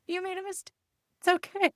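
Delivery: very high, cooing reassurance; imitated high-pitched comforting; soothing parent. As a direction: reassure a little kid in a high sing-out voice. Parent voicing wished comfort to child.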